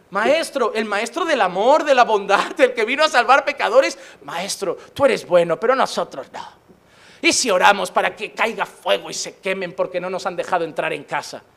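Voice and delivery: sheepish disciples. Retold or imitated. Disciples coaxing Jesus to burn a village, low and wheedling. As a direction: ask for fire from heaven like a naughty pupil.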